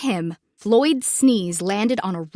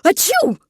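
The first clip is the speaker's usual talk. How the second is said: high loud sneeze burst; big sneeze; 10 semitones higher, 3 dB louder than usual. Acted. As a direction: let the sneeze explode. Sneeze after wind-up.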